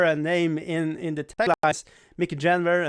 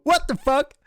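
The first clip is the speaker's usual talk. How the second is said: mock outrage; 8 semitones higher, 3 dB louder than usual. Mock outrage at new subscriber.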